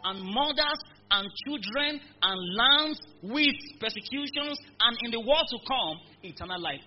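preacher shouting the promise to the congregation; full-force preaching. Acted. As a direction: thunder the promise over the church.

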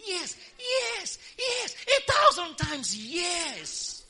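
high, loud, slow triumphant yes; ecstatic shout. Real, not acted. Preacher answering her own question, shouting triumphantly high. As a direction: shout it out like a victory cry.

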